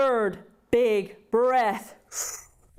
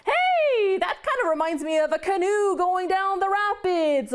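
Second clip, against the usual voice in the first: amazed onlooker; 7 semitones higher than usual. Acted. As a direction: call out like a dazzled person in the crowd. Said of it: "people right now who are looking up going".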